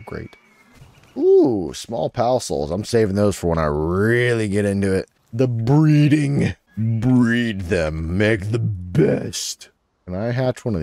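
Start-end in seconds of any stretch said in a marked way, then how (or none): 1.2-1.7 much higher, louder ooh; delighted ooh. Real, not acted. Spotting loot. 5.3-9.7 slow, put-on dramatic voice; mock dramatic voice. Musing about breeding pals.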